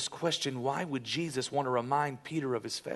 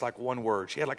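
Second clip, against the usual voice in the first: usual speaking voice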